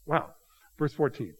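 normal talking voice

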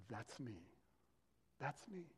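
very soft, almost breathed confession; soft, intimate reveal to the disciples; hushed reveal. Retold or imitated. Jesus' hushed self-revelation.

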